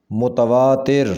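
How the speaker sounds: متواتر ending in "tir", "mutavaatir"; correct pronunciation. Shown as a demonstration. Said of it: "صحیح تلفظ ہوگا".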